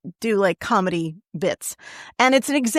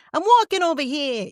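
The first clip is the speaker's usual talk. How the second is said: higher, hammed-up New Yorker; comic New York lead-in line, pitched up; exaggerated New York accent; 6 semitones higher than usual. Shown as a demonstration. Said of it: "give me a little New Yorker".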